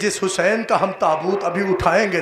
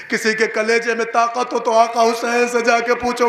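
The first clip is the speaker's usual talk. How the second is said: normal talking voice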